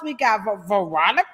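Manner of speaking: ordinary delivery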